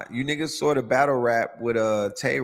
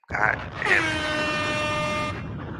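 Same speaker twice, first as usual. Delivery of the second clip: drawn-out; 3 dB quieter, much slower than usual. Acted. Drawn-out exclamation.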